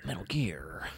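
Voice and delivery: whispered gritty soldier voice; Snake voice. Acted. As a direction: breathe it like a covert op soldier. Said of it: "impossible not to do a snake voice".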